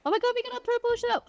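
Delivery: fast, high burst of delight; excited. Real, not acted. Streamer spotting the purple shop, fast high excitement. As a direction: blurt it high and quick, thrilled.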